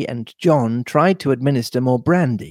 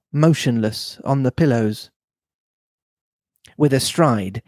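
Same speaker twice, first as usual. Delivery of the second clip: plain reading voice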